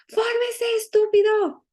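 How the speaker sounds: high, furious yelled insult; angry shout. Retold or imitated. Director shouting again at the boy who didn't line up, high angry yell. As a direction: scream it down at the boy in rage. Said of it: "Y repetía otra vez".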